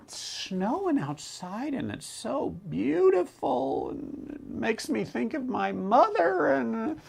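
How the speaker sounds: high, whispery, drawn-out fake weeping; mock crying. Speaker imitating people who cry over snow, mock tearful. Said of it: "I know some people who cry because".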